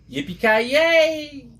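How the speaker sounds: slow, high whooping yell; catchphrase yell. Acted. Closing the Christmas story, a slow high cowboy whoop. Film catchphrase shouted at end.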